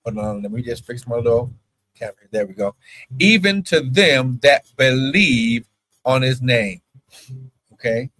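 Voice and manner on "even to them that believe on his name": slow, drawn-out scripture line; preaching. Acted. Preacher pressing the verse on his online church, slow. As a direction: lean on each word of the verse.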